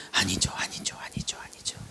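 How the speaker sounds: rapid whispered pleading, fading away; hushed mock prayer; reluctant prayer. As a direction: whisper the denial over and over. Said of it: "아닌 쪽으로 막 기도를 몰아가".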